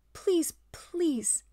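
whispered, small and high; high lamb whisper. Acted. Timid lamb begs the ape, whispering. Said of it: "the high voice of a woolly lamb".

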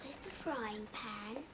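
whispered question; a child whispering in a stranger's kitchen. Acted. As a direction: ask it under your breath. Child asks quietly in a strange house.

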